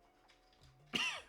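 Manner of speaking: sharp strained choking cough. Real, not acted. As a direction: hack it out, choking. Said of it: "Je m'étouffe".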